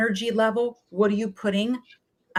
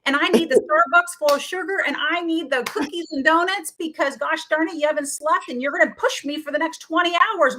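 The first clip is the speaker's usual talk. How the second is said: pitched-up craving little gremlin, demanding; greedy gremlin; 8 semitones higher, 4 dB louder than usual. Pretend. Host voicing the ghrelin gremlin to listeners, pitched-up and demanding. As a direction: play the hungry gremlin begging for sugar now. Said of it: "imagine he's like a gremlin".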